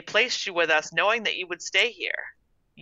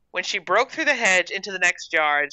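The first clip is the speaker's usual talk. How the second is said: usual speaking voice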